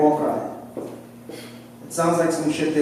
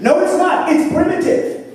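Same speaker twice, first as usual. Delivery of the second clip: mock outrage; 13 semitones higher, 4 dB louder than usual. Real, not acted. Arguing back at a friend.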